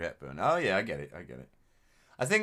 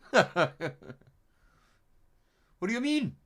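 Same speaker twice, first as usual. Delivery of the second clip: put-on high, loud indignant Welsh accent; high, loud offended Welsh accent; angry Welshman; 8 dB louder than usual. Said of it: "He got so mad at me".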